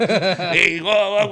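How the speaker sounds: loud excited cheer; kids cheering. Retold or imitated. Kids cheer while jumping in back of van, loud. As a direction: whoop like kids jumping around.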